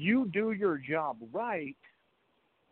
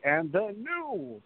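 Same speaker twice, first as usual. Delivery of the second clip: slow, drawn-out and the new; ring announcer call; 5 semitones higher, much slower than usual. Retold or imitated. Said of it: "everybody was so excited to say".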